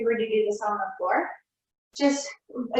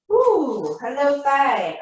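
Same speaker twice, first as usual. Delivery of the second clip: higher, louder surprised ooh; 3 semitones higher, 4 dB louder, much slower than usual. Real, not acted. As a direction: react to the sudden burn. Feels the burn in her thigh.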